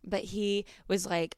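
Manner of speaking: ordinary delivery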